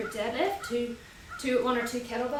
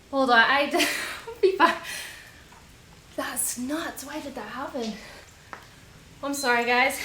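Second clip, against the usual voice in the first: normal talking voice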